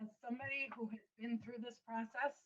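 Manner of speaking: faint and far from the mic; like talking from inside a tunnel; echoing tunnel. Real, not acted. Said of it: "It sounds like you're in a tunnel".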